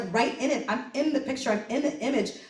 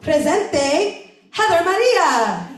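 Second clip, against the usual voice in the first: MC calling out; 6 semitones higher, 6 dB louder, much slower than usual. Retold or imitated. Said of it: "so then basically he's like".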